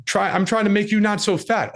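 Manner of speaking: high, sputtering, worked-up wife voice; mock angry wife. Wife snaps at husband who attacked her over the sandwich, high and sputtering. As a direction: stammer back at him high and flustered.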